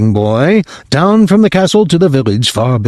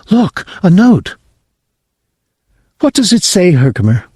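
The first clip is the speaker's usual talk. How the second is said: worried mother; 8 semitones higher than usual. Acted. Mother telling her husband they have no money, higher and anxious. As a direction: fret like a poor mother.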